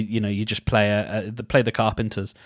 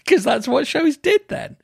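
high-pitched, laughing through the words. Real, not acted. Laughing after singing the theme.

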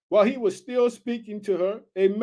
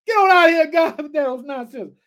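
loud, higher, laughing scoff at God; hammed-up crowd jeering through a laugh; mocking laughter; 6 semitones higher, 7 dB louder than usual. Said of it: "the crowd laughed at him".

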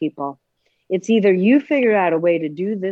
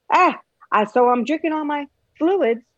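high, louder put-on patient voice; 7 semitones higher, 4 dB louder than usual. Patient telling Jill about fluids, high put-on voice. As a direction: do the silly voice she gives her patients. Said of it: "they all talk like that".

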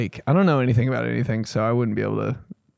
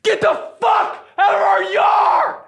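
loud, high, stretched-out yell; pissed-off janitor; 5 dB louder than usual. Retold or imitated. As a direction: roar it like an enraged janitor. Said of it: "tune in to janitor that was pissed".

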